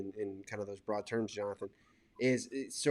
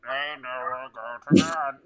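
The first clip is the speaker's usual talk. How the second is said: mock cheap speaker distortion, louder at the end; distorted owl speaker; 7 dB louder, slower than usual. Wife's call to Noah through the owl camera, distorted and eerie. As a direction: imitate a tinny, warped baby monitor. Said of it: "But it sounds like".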